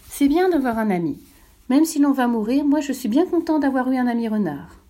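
a hint of a child speaking up; little prince voice.

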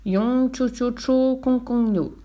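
sorcerer's high, drawn-out mock Chinese reply; same nonsense phrase, intoned slightly differently; slow, high nonsense Chinese answer; slightly different intonation. A narrator's reading. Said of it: "sur un ton légèrement différent".

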